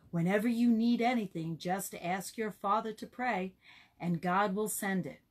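ordinary delivery

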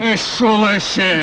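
furious name-calling; angry yelling. Acted. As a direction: hurl the insult at a rival. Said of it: "Ne bağırıyorsun?".

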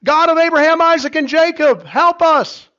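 very high, loud pleading prayer; desperate cry for help. Shown as a demonstration. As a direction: cry out to the Lord. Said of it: "instead of just saying".

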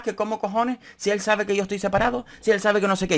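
high mocking whine; mocking imitation. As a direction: parrot his protest. Mocking Ginés quoting him.

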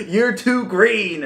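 hammed-up put-down of a rookie; mock Piccolo retort. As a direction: friend hamming up Piccolo snubbing a rookie soldier. Piccolo's retort retold by the friend.

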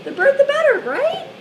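high loud squeal; excited. Real, not acted. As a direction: squeal it out gleefully. Excited squeal after argument.

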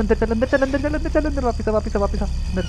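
high, urgent calls to a teammate; frantic, pitch climbing as he begs him back inside; real panic, voice shooting up; panicked calling. Real, not acted. Player panics as doll approaches.

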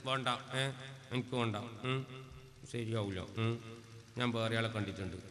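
quiet, low, coy little voice; shy soft refusal. Acted. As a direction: mumble it shyly like a bashful girl. Daughter's shy refusal to father. (നാണത്തോടെ പതുക്കെ)

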